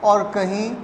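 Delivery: normal talking voice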